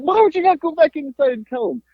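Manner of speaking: very high mock-mother scolding; imitated mom. Mom scolding son for not telling dad, high-pitched mock exasperation. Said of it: "She's like".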